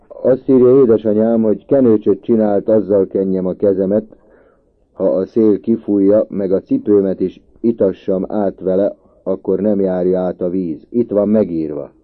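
put-on boy pleading his case loudly; earnest insistence. A narrator's reading. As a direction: insist on it, voice pushed up. Boy insists, citing mother's letter.

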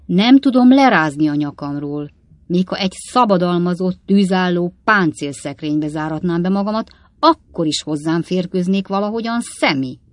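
lightly voiced father, loud grumbling; exasperated old father. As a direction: complain emphatically to your son. Old Weller complains of widow.

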